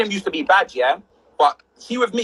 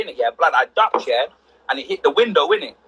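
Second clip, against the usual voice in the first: ordinary talk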